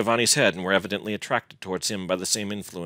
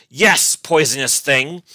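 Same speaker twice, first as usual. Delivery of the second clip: slow, loud, high-pitched venom; impassioned angry shout; beside himself with passion; 6 semitones higher, 9 dB louder, slower than usual. A narrator's reading. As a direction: drag out the insult furiously. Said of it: "feeling beside himself with passion".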